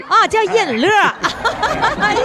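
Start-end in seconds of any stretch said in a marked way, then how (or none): 0.9-1.9 rising laugh, delighted; hearty laugh. Real, not acted. Host laughs after correction. (开心大笑)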